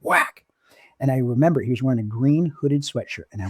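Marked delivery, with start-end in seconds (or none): 0.0-0.4 sharp, much higher whack; mock punch noise, high and punchy; imitated punch sound. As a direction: snap out the hit like a sound effect.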